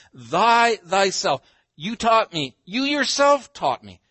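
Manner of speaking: THY THYSELF and YOU YOURSELF high and very loud; loud, forceful emphasis on "thyself" and "yourself"; emphatic stress. Shown as a demonstration. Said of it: "writer's done just that".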